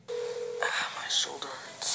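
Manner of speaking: soft unvoiced whisper of pain. Real, not acted. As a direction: whisper the complaint. Whispering pain after a call.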